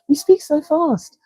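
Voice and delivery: high mock quote of a complaint about her pace. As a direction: squeak it like a fussy listener. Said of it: "Some people say".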